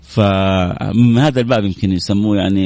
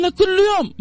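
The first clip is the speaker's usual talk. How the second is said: high mock matter-of-fact reply; artist confirms. Artist answering the anchor, high and matter-of-fact. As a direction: state it plainly, as if obvious.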